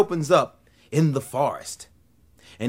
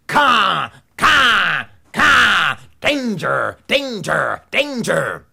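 loud cawing bird call; crow alarm call; 6 semitones higher, 6 dB louder, much slower than usual. Acted.

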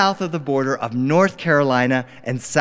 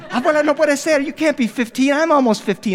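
put-on disbelieving kid; 9 semitones higher than usual.

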